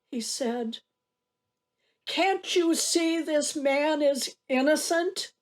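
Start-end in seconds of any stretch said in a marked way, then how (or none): 2.1-5.3 raised, impassioned protest from agony; speaking up. Acted. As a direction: cry out in defence of an innocent man. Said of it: "spoke up".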